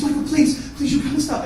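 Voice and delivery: high-pitched imploring imitation; pleading wife. Wife begging her husband to stop, high and pleading.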